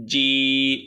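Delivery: "জি" loud with a long level "ee"; drawn-out letter sound. Shown as a demonstration. Teacher showing tongue on palate, firm drawn-out "জি". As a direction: press a hard j into a held "জি". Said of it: "চার থেকে ছয় আলি পরিমাণ টেনে".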